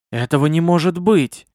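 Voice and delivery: plain reading voice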